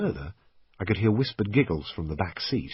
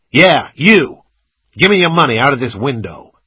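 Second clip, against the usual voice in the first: very loud, raised robber's command; shouted demand; 7 semitones higher, 13 dB louder than usual. A narrator's reading. Gunman demands passenger's money, loud shout. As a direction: yell the order, no argument allowed.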